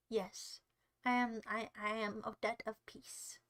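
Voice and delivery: low, soft, serene murmur; peaceful persona. Pretend. Streamer declaring himself Odette of peace, hushed serenity. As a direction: settle into a hushed, peaceful calm.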